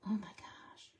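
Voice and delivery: soft voiceless whisper. Real, not acted. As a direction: breathe it out in a whisper. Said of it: "I have to whisper".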